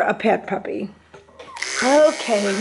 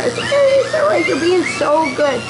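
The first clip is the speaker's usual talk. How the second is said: higher cooing reassurance; reassuring pet talk; 6 semitones higher than usual. Real, not acted. Groomer calming puppy after missing a wire, reassuring. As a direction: comfort the puppy in a high sweet-talk voice.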